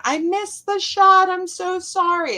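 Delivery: high, pleading I missed the shot, I'm so sorry; lightly voiced insecure teenage player; approval-seeking apology. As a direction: whine it like an anxious teen. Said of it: "I'm seeking approval".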